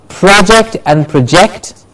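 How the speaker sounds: PROject, then proJECT; stress contrast. Shown as a demonstration. Teacher introducing noun and verb, stress shifting on project. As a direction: say project stressed first in front, then at the back. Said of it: "Do you hear the difference?".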